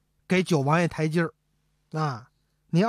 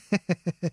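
a short, sly falling chuckle. Acted. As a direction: chuckle like a schemer springing a trap. Heshen chuckles at his trap. (和珅得意地阴笑)

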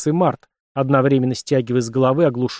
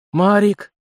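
joyful cry, higher and louder; радостно; 8 semitones higher, 4 dB louder than usual. A narrator's reading. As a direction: burst out with happiness. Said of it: "только и смог выдавить из себя". (выдохнул радостно)

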